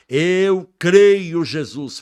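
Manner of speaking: loud, high declaration; declaring faith. Retold or imitated. Desperate son declaring faith to Jesus, loud and high. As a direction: cry out the belief. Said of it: "Falei a primeira vez".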